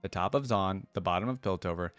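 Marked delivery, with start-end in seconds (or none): none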